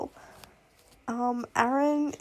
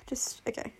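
overwhelmed whisper; 9 dB quieter than usual. Real, not acted. Overwhelmed sigh then whisper.

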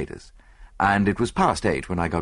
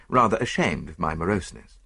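ordinary delivery

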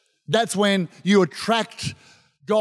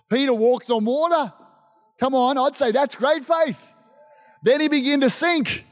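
normal talking voice

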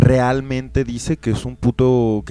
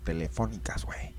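whisper; 6 semitones lower, 12 dB quieter than usual. Real, not acted. Dreamy aside about phone offers.

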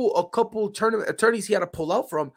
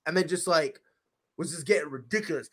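normal talking voice

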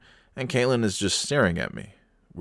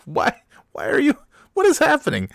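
very high-pitched, louder disbelief; bewildered outburst; 6 dB louder than usual. Retold or imitated. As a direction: squeak out your utter bafflement. Baffled dad amid family argument.

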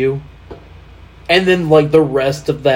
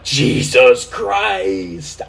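higher, very drawn-out mock exclamation; retold astonishment; 5 semitones higher, much slower than usual. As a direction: replay your disbelief, stretching both words slowly. Retells reaction to stadium shot.

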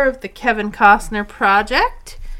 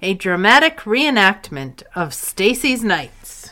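announcer voice; 5 dB louder than usual. Acted. Announcer intro to a sketch.

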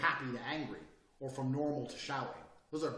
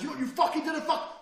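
high, loud mock fit; hammed-up manic yell; crazy screaming; 12 semitones higher, 5 dB louder than usual. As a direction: burst into an acted screaming rage. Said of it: "going from crazy screaming to nice".

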